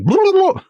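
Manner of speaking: high burbled gobble sound; silly high-pitched mouth noise; gobbling noise. Acted. Imitates noisy gobbling.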